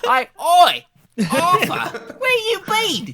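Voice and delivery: loud, high, hammed-up Cockney accent; Mira. Mira calling out to Arthur, over-the-top loud Cockney accent. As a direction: go all the way, screechy Cockney holler. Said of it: "I made her Cockney".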